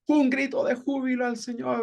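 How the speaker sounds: jubilant call. Shown as a demonstration. Preacher demonstrating a shout of joy to the Lord, jubilant call. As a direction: call it out high to rouse a congregation. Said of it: "Son expresiones en fuerte y alta voz".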